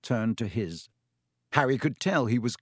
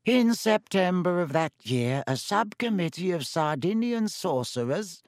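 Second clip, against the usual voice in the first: put-on higher, dry wheezy old lecturer; Professor Binns, wheezy lecturing; 6 semitones higher than usual. A narrator's reading. Ghost teacher resuming his dull lecture, dry and wheezy. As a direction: drone on in a high, dry wheeze. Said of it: "he stuttered to a halt".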